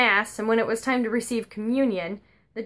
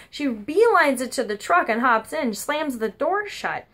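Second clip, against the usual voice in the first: normal talking voice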